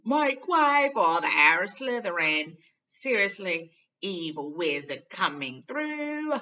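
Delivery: loud, slow mock announcement; shouting. A narrator's reading. Fred and George mockingly shout ahead of Harry in the corridor. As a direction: herald him down the corridor.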